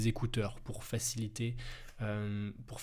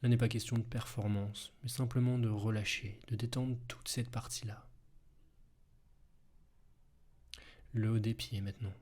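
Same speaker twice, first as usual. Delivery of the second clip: guided hypnosis. Acted. Hypnosis body relaxation.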